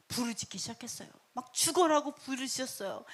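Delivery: usual speaking voice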